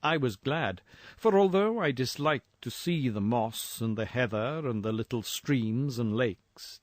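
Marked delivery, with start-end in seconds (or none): none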